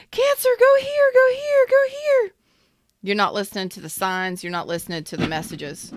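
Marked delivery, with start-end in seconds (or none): 0.1-2.3 very high, loud sing-song call of go here; a soul calling out in a high piping voice. Retold or imitated. Reader voices the soul calling Cancer, high and loud. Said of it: "your soul is calling out to you saying".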